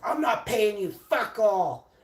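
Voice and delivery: loud, high-pitched refusal; imitated angry boss. Boss phones back refusing to pay worker, mock yell. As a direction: mimic a boss yelling his refusal.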